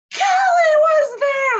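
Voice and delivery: high, loud, drawn-out exclamation; very animated. Retold or imitated. Patient suddenly remembers who he saw, animated shout. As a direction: act out his excited outburst, stretching the words. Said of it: "Like, he's so animated".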